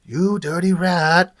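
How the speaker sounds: slow, drawn-out mobster impression; a hint of a gangster drawl, slowed down; imitating the movie line. Reviewer quoting the turtle's movie line as a slow mock gangster. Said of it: "You remember that when they're with April".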